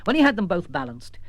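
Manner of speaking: ordinary delivery, nothing marked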